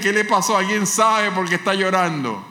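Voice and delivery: concerned Jesus, high and loud in preaching fervour; Jesus voiced. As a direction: ask after the weeping man with concern. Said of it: "que me ve llorando y dice". (Jesús preocupado, en voz de predicador)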